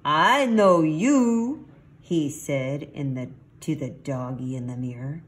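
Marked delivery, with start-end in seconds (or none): 0.0-1.6 loud, high, drawn-out greeting; excited recognition. A narrator's reading. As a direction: stretch it out, loud and delighted to recognize. Kitty hails his mirror image.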